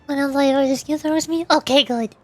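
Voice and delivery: normal talking voice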